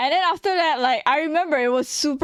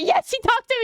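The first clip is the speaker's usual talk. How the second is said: high, laughing little cheer; giddy delight; 12 semitones higher than usual. Retold or imitated. Going home happy that he talked to her, giddy. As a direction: retell a giddy celebration through laughter. Retold happiness after he spoke.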